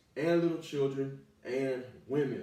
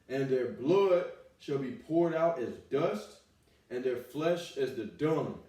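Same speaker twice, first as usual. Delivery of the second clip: slow, loud preaching of the verse; fiery scripture reading; 5 dB louder, slower than usual. Acted. Preacher reading doom verses to the wicked, slow and loud. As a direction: drag out each word of doom.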